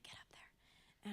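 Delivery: whispered, drawn-out nudge; hushed prompt. Retold or imitated. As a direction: quote it very softly, almost under your breath. God's nudge, retold.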